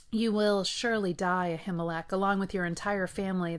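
usual speaking voice

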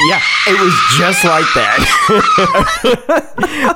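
high, slow, laughing. Real, not acted. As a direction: laugh out the words. Laughing agreement about the scream.

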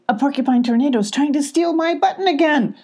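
alarmed. Acted. Abby alarmed at tornado.

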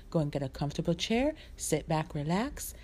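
ordinary delivery

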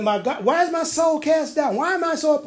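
high, pleading cry to God; psalmist crying out. Acted. Psalmist crying to God about his downcast soul, high and pleading. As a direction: lift it up in anguish. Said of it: "He said".

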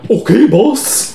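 slow, high dopey thug voice; dim-witted henchman. Acted. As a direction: drag it out like a dim sidekick. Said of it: "one of them's not so swift".

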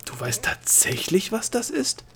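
slow, breathy, rising high in amazement; astonished. Acted. Gnome is amazed Delia knows the splinters, astonished. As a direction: marvel that she knows. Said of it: "staunte der Gnom".

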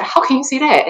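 high-pitched mock protest; exasperated, pitched well up; retold indignation. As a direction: imitate an upset athlete challenging the coach. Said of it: "like, really pissed off".

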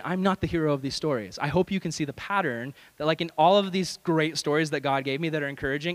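ordinary talk

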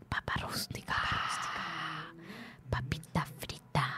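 slow, soft whisper about potatoes; mock ASMR whisper. Teasing the co-host with a fake ASMR clip, whispered.

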